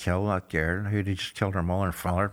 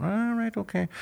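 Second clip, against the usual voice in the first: mock startled "all right, okay", jumping much higher; 13 semitones higher than usual. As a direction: pretend to be caught off guard, voice shooting up. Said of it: "and I'm going".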